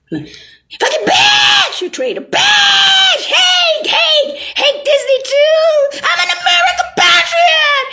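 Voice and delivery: shrieking high furious yell; screaming rant. Retold or imitated. Ranting at the traitor, a shrieking furious yell. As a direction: scream it like a crazed patriot.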